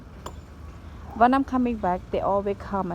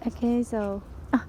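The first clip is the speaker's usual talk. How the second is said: normal talking voice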